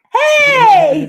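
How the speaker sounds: high, loud, drawn-out call; whooping, laughing greeting; delighted shout. Real, not acted. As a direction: cry out with a laugh in it. Greets tortoise on camera.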